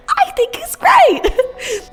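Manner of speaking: high-pitched voice. Shown as a demonstration. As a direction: squeak it out sweetly in a high voice. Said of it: "I say it with a high-pitched voice".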